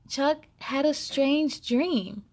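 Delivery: usual speaking voice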